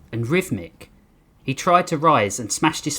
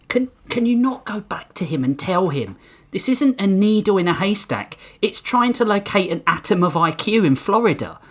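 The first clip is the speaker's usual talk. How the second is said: pleading whisper; 7 semitones higher than usual. Acted. Lee pleading with Hank.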